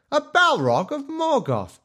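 mock squeaky wizard; squeaky high wizard voice. Shown as a demonstration. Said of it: "if he was a wizard and he's like".

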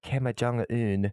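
Kim Jong-un drawn out in a mock accent; exaggerated, stretched accented name. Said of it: "You must say it with the accent".

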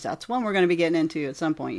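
normal talking voice

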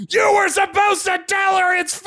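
high-pitched outraged scream. A narrator's reading. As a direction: scream the accusation, drawing out the end. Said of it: "He screamed".

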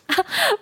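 bright rising giggle; a quick high tittering laugh; high delighted laugh. Acted.

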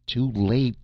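urgent cry. A narrator's reading. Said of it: "Sarja cried".

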